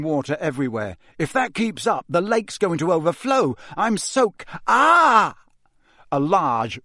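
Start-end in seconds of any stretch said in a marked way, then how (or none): 1.2-4.5 higher-pitched, bouncing grumble; worked-up moaning, cut off mid-word; agitated complaint. A narrator's reading. Drenched Ron complains, cut off. 4.7-5.4 loud, high cry of shock; sudden "aah" cutting off the sentence; startled yell. A narrator's reading. Water balloon explodes on Ron.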